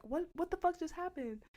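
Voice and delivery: high-pitched retold alarm; startled confusion. Reacting to friend's sudden yelp, startled confusion. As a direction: ask it high, taken aback.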